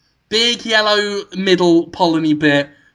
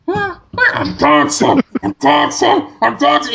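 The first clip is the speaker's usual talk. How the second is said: high, slow chant fading down; a flower singing from inside a shut drawer; muffled from the drawer; 8 semitones higher, slower than usual. Retold or imitated. Flower shut in a drawer, high fading chant. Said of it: "you can just hear".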